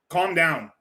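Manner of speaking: ordinary talk, nothing marked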